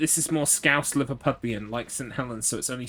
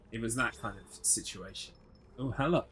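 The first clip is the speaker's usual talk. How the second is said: normal talking voice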